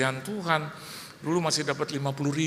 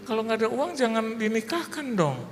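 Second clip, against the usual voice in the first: much higher, slowed, incredulous; exasperated retort; 10 semitones higher than usual. Retold or imitated. Pastor answering the borrowing neighbour, high incredulous retort. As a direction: blurt it out in disbelief.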